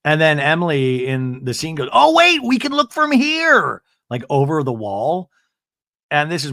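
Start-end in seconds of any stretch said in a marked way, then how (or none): 1.9-3.8 high, loud, slow eagerness; hammed-up eager squeal; mock eager. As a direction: squeal with nosy excitement. Imitating Emily eager to peek.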